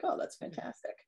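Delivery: breathless whispered awe. Real, not acted. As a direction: whisper it, amazed. Awed reaction to twist.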